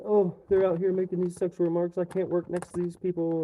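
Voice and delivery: mocking complaint. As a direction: sneer the complaint back.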